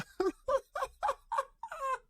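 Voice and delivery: a high breathy burst of real laughter. Real, not acted. Host laughing at a clip of a preaching woman. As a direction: break into a laugh you can't hold back. Reacting to absurd pastor clip.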